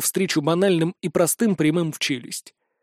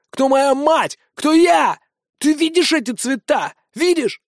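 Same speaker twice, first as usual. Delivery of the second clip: furious; 8 semitones higher, 3 dB louder than usual. Acted. Vincent threatening in rage.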